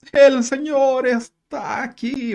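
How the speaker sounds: high, slow, drawn-out proclamation; mock prophetic cry, stretched out; effusive visionary proclaiming. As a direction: announce the Lord's presence like a man in a trance. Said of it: "lo dijo de una forma tan, eh, efusiva".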